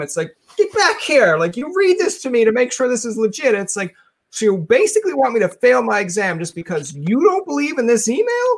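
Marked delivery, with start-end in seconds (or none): 0.6-3.5 demanding call. Retold or imitated. Friend demanding she read email. 4.3-8.5 incredulous retort. Retold or imitated. Incredulous retort to demanding friend.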